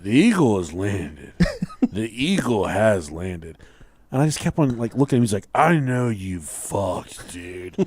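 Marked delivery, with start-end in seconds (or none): 0.0-3.6 put-on low, slow, cryptic drunken pronouncement; drunk brother. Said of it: "he was drunk and fall". 5.6-7.8 put-on very slow drunken insinuation; drunk brother. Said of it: "he was drunk and fall".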